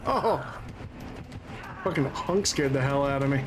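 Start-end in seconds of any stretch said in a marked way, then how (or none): none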